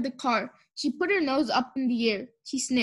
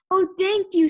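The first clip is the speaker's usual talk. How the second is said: higher, louder gush; relieved thanks; 6 semitones higher, 6 dB louder than usual. A narrator's reading. Mister Watson thankful Mercy is safe, gushing relief.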